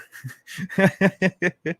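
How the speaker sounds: a real laugh at his own joke, falling away; amused chuckle breaking out; burst of laughter. Real, not acted. Laughs at Cookie Monster joke.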